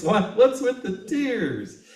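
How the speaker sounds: high, slow, concerned voice; worried partner. Retold or imitated. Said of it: "And he goes".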